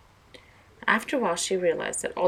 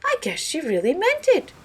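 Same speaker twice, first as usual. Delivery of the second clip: higher, louder, changed voice; a knowing old gossip; Mister Morley's voice; 11 semitones higher, 9 dB louder than usual. Acted. Mister Morley judging Doctor Peck aloud, higher changed voice. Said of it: "said Mister Morley".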